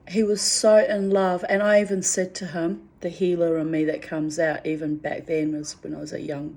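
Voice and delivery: normal talking voice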